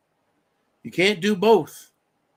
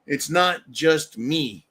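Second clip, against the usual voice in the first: usual speaking voice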